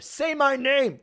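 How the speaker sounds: high, loud commanding cartoon shout; Yugi. Player doing a game hero's voice, high loud demand. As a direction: demand it loudly, voice jumping up. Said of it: "I'll do a Yugi voice".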